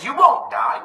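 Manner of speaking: high, sly tempter's lilt; put-on devil voice, swooping high. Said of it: "the devil comes and says".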